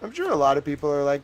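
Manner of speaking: usual speaking voice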